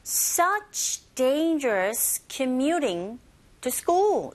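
very slow, punch every content word except "to"; stressed content words. Shown as a demonstration. Teacher shows learners the sentence stress, drawn out. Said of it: "이렇게요".

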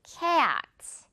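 long e-ah glide in cats; rubber-band cats. Shown as a demonstration. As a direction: stretch the A in cats for learners. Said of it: "이 발음 들리시나요".

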